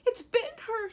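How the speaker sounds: very high, loud shriek; a banker shrieking as the snake bites; panicked cry. A narrator's reading. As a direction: squeal it in horror. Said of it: "he cried".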